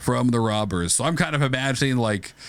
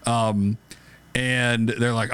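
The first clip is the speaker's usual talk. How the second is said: ordinary delivery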